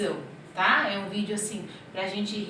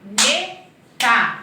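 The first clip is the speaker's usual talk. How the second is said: "Caneta" slow and louder, split into three beats; syllable by syllable; 7 dB louder, much slower than usual. Shown as a demonstration. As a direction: pull "Caneta" apart so kids hear each part. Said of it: "O K fica junto".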